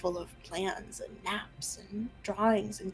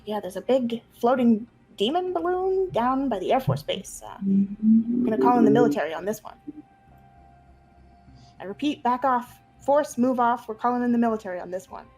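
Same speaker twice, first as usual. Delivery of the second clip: police radio voice; 3 semitones higher, 5 dB louder than usual. Acted. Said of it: "it's like a police radio".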